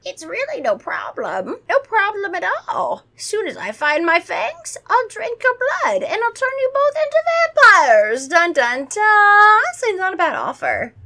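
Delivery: high theatrical vampire building to a loud flourish; hammed-up villain announcement; menacing vampire. A narrator's reading. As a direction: gloat with mounting vampire melodrama. Acting vampire threatening to bite kids.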